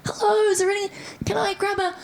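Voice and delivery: high small apologetic voice; timid shop voice. Retold or imitated. Walking into a shop acting small, high timid voice. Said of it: "I'd walk in like this".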